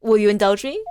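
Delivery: ordinary talk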